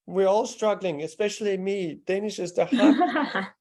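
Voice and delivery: laughing. Real, not acted. Laughing with student.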